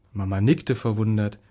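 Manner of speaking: ordinary delivery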